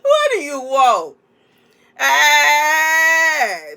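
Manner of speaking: loud, slow shout through a speaker; loudspeaker shout. Pretend.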